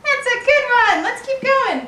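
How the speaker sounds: high, loud praise; excited praise. Real, not acted. Praising the walking baby.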